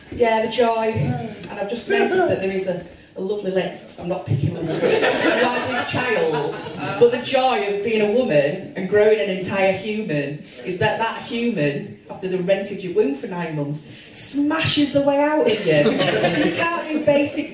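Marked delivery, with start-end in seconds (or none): none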